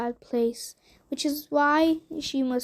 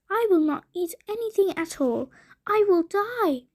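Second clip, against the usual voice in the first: dramatic wife; 6 semitones higher than usual. A narrator's reading. Wife threatening to starve.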